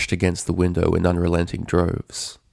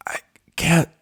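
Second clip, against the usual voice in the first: higher, strained choked gasp; choking Rainbow; 9 semitones higher, slower than usual. A narrator's reading. Rainbow choking under a hoof to the neck. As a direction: force the words past a crushed throat. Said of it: "she choked".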